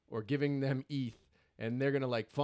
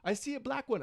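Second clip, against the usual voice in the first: excited; 13 semitones higher than usual. Retold or imitated. Still hyped while buying cats.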